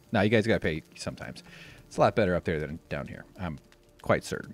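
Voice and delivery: normal talking voice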